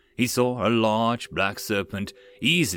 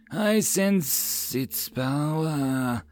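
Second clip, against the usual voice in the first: very slow, hissing snake voice; hissing serpent; 4 semitones higher, much slower than usual. Acted. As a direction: hiss it close in his ear. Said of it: "the serpent hissed at Raman in his ear".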